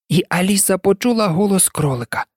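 ordinary delivery, nothing marked

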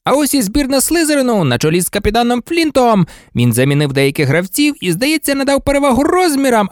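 sportscaster presenting a line-up; match commentator. Acted. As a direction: introduce the rival team over the crowd.

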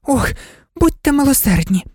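high, panting plea; breathless pleading. A narrator's reading. Exhausted Alice begs the king, breathless pleading. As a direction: beg while puffed from running. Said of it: "благала задихана Аліса".

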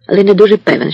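usual speaking voice

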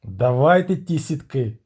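mildly gruff princely command; barked order. Acted. Prince orders the sailors to bring nets, mild bark. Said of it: "гарикнув Агловаль".

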